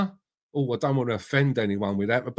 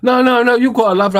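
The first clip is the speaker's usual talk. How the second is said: loud protest; 9 semitones higher, 10 dB louder, faster than usual. Real, not acted. Protesting loudly to guest.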